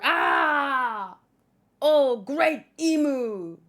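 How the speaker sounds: loud, slow worshipping cry. Acted. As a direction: call out to a dark master in awe.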